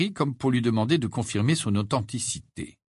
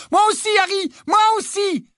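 put-on very high, loud girlish squeal; overexcited; 5 dB louder than usual. A narrator's reading. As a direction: shriek the news to a friend, thrilled. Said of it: "surexcitée en brandissant sa lettre".

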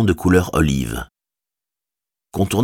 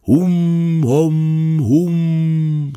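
distant answering horn calls; 10 semitones higher, much slower than usual. A narrator's reading. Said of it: "très similaire".

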